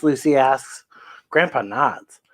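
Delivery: ordinary delivery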